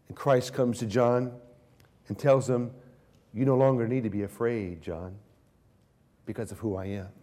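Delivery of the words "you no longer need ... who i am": quiet, gentle words to a frightened man; soft reassurance. Retold or imitated. Christ quietly reassuring frightened John. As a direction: soothe him softly, like calming a friend. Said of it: "And he reassures John".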